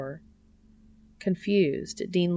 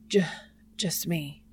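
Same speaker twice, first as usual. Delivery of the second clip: stuttering. A narrator's reading. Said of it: "Dean stutters".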